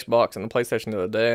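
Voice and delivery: ordinary talk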